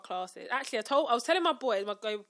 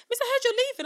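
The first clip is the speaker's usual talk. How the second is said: much higher, loud student voice; imitating a student; 14 semitones higher, 10 dB louder, faster than usual. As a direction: pipe up as a pupil calling to the teacher. Acting a student approaching her.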